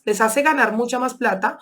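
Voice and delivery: usual speaking voice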